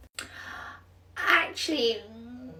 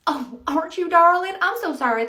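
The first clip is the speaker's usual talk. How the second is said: gushing Southern charm; 5 semitones higher, 9 dB louder than usual. Acted. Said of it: "That's just how I talk".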